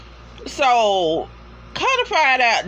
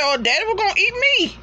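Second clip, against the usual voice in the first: guy's excuse; 11 semitones higher, much faster than usual. Retold or imitated.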